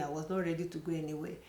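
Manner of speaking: usual speaking voice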